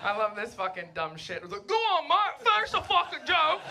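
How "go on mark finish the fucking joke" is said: mock heckler. Voicing audience heckling her.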